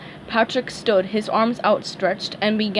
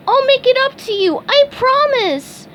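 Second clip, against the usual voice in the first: growling Patrick; 6 dB louder than usual. A narrator's reading. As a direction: promise it through a growl. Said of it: "Patrick growled".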